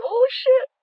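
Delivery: loud, high shocked exclamation; excited shout. Retold or imitated. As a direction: yell it in shocked delight. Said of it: "slapping the table and going".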